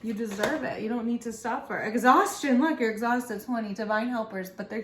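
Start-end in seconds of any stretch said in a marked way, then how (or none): 0.0-1.8 urging angels and guides. Acted. Angels telling you to get out with friends, urgently pleading. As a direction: act out exasperated guides pushing someone out. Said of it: "your angels and your guides are literally like".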